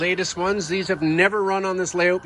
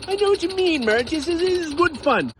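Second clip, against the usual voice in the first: put-on higher, cheerful old gentleman; Henry; 11 semitones higher than usual. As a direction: play a pleased old man in a raised voice. Henry agrees with Marge.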